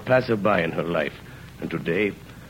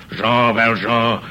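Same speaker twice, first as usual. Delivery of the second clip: drawn-out, slow called name, a touch louder; imagined shout; 3 semitones higher, 5 dB louder, much slower than usual. Retold or imitated. Convict imagining police calling his name, slow mock shout. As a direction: call the name out slowly like a policeman in the night. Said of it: "you hear a voice shout".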